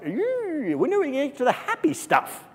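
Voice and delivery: mock whiny listener. Pastor mocking bored congregants, high whiny voice. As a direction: mimic a bored listener whining. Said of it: "some of you are like".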